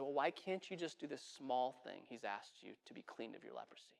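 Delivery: very soft, low-voiced appeal; gentle coaxing. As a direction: quietly persuade a stubborn friend. Friend gently reasons with captain.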